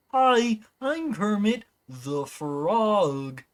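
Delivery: Kermit the Frog with a high hi and slow up-down lilt; Kermit impression. Shown as a demonstration. Showing chat Kermit's up-down inflection, slow frog voice. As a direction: stretch Kermit's sing-song ending, slowly. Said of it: "He does this little up-down thing at the end of his sentences".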